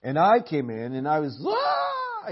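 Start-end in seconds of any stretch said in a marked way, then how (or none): 1.5-2.3 high mock alarm yell; a sudden startled "Ah!"; retold yell. Father retelling how he yelled at his son, mock yell.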